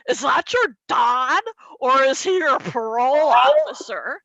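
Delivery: mocking heckler. Stranger at concert taunting, loud and snide. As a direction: sneer it at the daughter.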